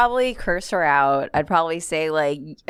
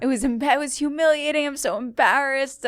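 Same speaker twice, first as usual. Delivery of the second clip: retold tearful complaint; 8 semitones higher than usual. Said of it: "She's just crying".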